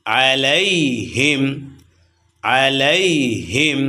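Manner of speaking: আলাইহিম drawn out slowly, syllable by syllable; slow demo. Shown as a demonstration. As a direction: stretch আলাইহিম with a short quick লাই. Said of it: "আইন জবর আ".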